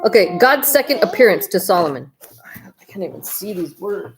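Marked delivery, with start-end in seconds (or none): 2.3-4.2 tired slow whisper. Real, not acted. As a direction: breathe it out, too tired to read. Tired mother at bedtime.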